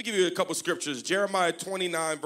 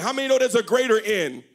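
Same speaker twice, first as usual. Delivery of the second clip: ordinary talk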